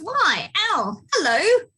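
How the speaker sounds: high little mouse voice; cheery mouse greeting. Acted. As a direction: call hello as a tiny mouse.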